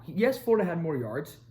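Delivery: normal talking voice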